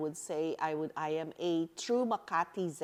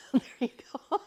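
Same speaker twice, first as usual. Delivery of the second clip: 1 laughing; 8 semitones higher, 4 dB louder, faster than usual. Real, not acted. Laughs at Filipino term.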